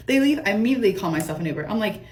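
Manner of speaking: usual speaking voice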